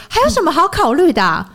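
loud high-pitched outburst, retold; hysterical yell. Boss yelling hysterically at a parent. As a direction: mimic the boss losing it at the parent. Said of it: "开始歇斯底里就说".

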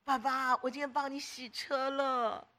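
put-on slow, softened small-child voice; happy little child. Little son telling dad about the car, childlike. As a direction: proudly tell daddy, drawing the words out. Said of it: "他很高兴跟爸爸说".

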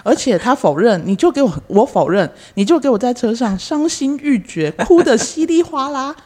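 loud, high indignant tirade; indignant rant. Acted. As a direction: scold her, building outrage.

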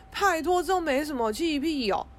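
high and loud, waving it away; dismissive brush-off. Pretend. To the upset boyfriend, a loud dismissive scoff. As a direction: scoff like it's nothing at all. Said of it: "我也不是说".

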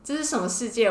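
normal talking voice

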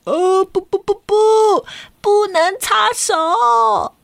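put-on high, dragged-out child's no; child protesting. Kenta begs the lady not to wipe his hand.

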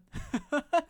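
loud high rising laugh; burst of laughter. Real, not acted. Retelling the bad massage, laughing out loud. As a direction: laugh at your own fury.

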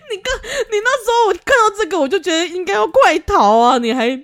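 high, half-crying through laughter; tearful laughing exasperation; laugh-crying urging. Real, not acted. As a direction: plead with her to run, laughing. Urging her to flee.

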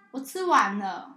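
put-on slow, loud drawn-out child's report; the child running over to say he's done; proud kid. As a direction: stretch it out proudly.

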